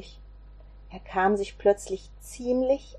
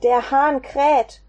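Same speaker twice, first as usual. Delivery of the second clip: higher and louder, slow, dawning horror on Hahn; shocked realisation; 5 semitones higher, 4 dB louder, slower than usual. Acted. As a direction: let the truth hit him mid-sentence. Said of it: "durchfuhr es Petrus". (erschrockene Erkenntnis, langsam)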